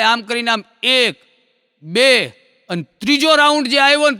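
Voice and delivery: ordinary delivery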